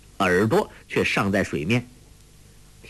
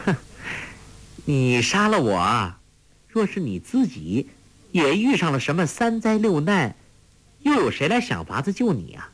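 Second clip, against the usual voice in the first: scoff, then lilting higher pitch; playful needling, rising into a higher lilt; teasing taunt; 6 semitones higher than usual. A narrator's reading. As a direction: taunt him with smiling confidence. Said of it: "黄蓉笑道".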